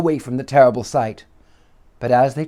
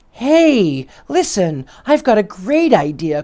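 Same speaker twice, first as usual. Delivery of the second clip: high, loud eager call; excited boy; 7 semitones higher, 5 dB louder than usual. Acted. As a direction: burst out with a bright idea. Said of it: "cried Tommy".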